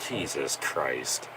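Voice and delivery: low, slow, weary "Jesus Christ" under the breath; exasperated mutter. Real, not acted. Fan groaning at the wrestler's new gimmick, low and slow. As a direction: sink the words in disbelief.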